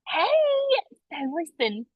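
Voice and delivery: lightly imitated Southern Belle accent, softening at the end. Coach imitates a cute colleague approaching someone, in a Southern Belle accent. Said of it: "She's so stinking cute with her Southern Belle accent".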